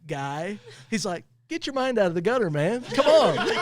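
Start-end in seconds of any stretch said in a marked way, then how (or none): 1.5-3.6 laughing. Real, not acted. Brother's joke, laughing retelling.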